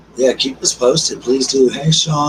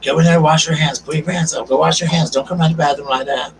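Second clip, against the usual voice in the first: put-on higher, louder mom scolding small kids; nagging mom voice; 5 semitones higher, 4 dB louder than usual. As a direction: bark orders at kids leaving the bathroom. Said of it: "So I'm like".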